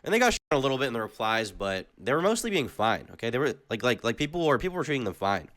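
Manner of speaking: ordinary delivery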